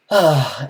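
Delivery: heavy, loud exhale; weary sigh. Real, not acted. Streamer moving on from stream trouble, loud sigh. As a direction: let out a big exasperated sigh.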